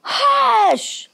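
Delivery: high, long-held hush; scolding hush. A narrator's reading. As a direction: scold as a whole town at once. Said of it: "the whole town scolded".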